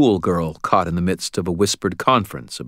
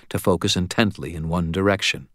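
usual speaking voice

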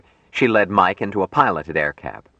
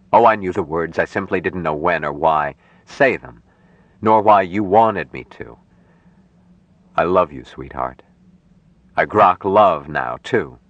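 earnest, loving Mike; 2 semitones lower than usual. Acted. Mike tells Jill he groks people, earnest and loving. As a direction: savour each endearment, lightly lowered voice.

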